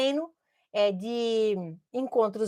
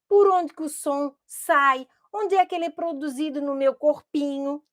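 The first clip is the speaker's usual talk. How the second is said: ordinary talk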